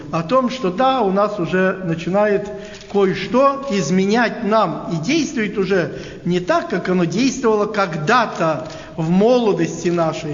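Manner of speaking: ordinary talk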